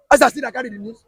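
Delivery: ordinary talk, nothing marked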